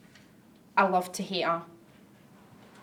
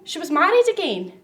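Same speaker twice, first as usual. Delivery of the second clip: high, louder burst of scandalised gossip; scandalised exclamation; 7 semitones higher, 5 dB louder than usual. Acted. Gossip about much-married aunt.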